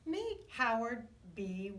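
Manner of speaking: ordinary delivery, nothing marked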